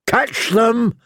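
high, excited fool's cry; exclaiming jester. A narrator's reading. Jester exclaims as chicks escape, high excited cry. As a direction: call out high as a clown. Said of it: "Butterbumps exclaimed".

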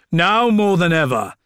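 curt, loud gruff snap; snapped. A narrator's reading. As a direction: snap it back at him. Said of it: "snapped Cyprian".

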